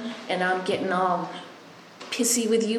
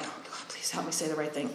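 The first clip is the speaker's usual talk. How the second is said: hushed little prayer under the breath; quiet prayer; 4 semitones lower, 14 dB quieter than usual. Retold or imitated. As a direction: murmur a plea to God, barely voiced.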